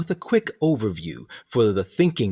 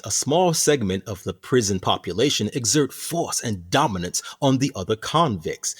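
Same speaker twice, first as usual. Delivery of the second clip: ordinary talk, nothing marked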